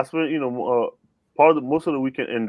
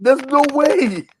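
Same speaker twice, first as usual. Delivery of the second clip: high, slow no-way drawl; drawn-out disbelief; 13 semitones higher, slower than usual. Real, not acted. Friend teasing the host, drawn-out high disbelief. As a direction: stretch it out in disbelief.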